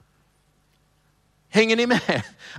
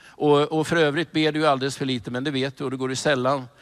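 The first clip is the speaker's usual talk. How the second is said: usual speaking voice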